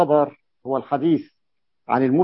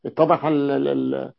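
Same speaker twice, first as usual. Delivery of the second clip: ordinary talk, nothing marked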